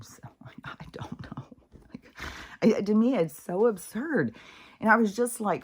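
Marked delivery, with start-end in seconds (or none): 0.0-1.5 voiceless trailing whisper; whispered aside. Real, not acted. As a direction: let it fade into a whisper.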